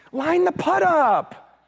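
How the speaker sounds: high, drawn-out call to his son; retold coaching call. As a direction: stretch the words out high, calling across the green.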